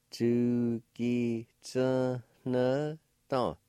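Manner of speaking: "Chu ki tsu ne dong" stretched very slowly; drawn out for a learner to copy; very slow demo. Shown as a demonstration. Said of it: "like this".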